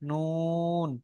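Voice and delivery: নুন held long on a level note, softly; soft, drawn-out model of নুন; articulation demo. Shown as a demonstration. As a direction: stretch the vowel of নুন evenly. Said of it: "তালুর সাথে লেগে উচ্চারণ হয়".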